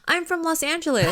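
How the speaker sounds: high mock voice of the American actor; conductor's own voice. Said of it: "he's like".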